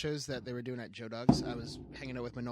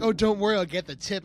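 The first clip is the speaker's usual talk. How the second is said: higher, louder carefree chirp; mock girl offering the tip; breezy girl voice; 6 semitones higher, 10 dB louder than usual. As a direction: toss it off like it's nothing. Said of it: "she's like".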